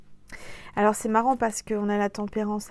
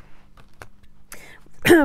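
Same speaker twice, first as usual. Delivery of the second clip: choking cough; 8 semitones higher, 5 dB louder than usual. Real, not acted. Swallowed the wrong way.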